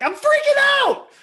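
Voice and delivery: very high, loud panicked outburst; freaking out. Shown as a demonstration. Coach shows a frantic paraphrase for an acting line. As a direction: explode in panic. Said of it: "but I wanna say is".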